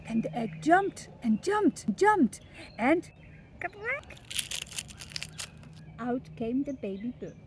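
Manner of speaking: ordinary delivery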